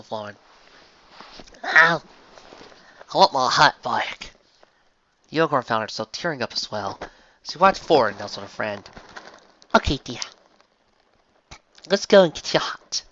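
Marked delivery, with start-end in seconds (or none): 1.6-4.3 slow southern voice; half-crying joke; teary Applejack. Acted. 9.7-13.0 higher, gentle voice; comforting Rarity. Acted.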